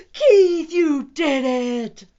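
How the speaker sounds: gasp, then loud high delight; loud, drawn-out celebration; cheering. A narrator's reading. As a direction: cheer with amazed joy. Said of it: "the other cats cheered".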